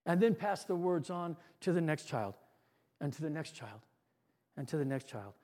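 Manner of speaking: storyteller hushing as the words pass along; fading hush. A narrator's reading. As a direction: let it die away down the line. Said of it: "as the words are whispered".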